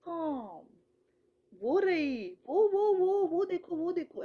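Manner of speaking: high, loud eager वो, वो, वो; pointing out to child. Shown as a demonstration. Mother shows how she pointed the comb out to her daughter. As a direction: call the child's eye to the comb. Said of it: "ऐसे उसको समझ आने लग गया".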